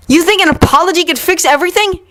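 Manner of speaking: high, fast angry challenge; countering. Acted. Yuri counters Victor, fast and angry. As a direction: fire the question back. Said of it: "Yuri countered".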